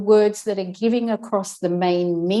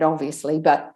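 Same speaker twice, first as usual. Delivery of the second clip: ordinary talk, nothing marked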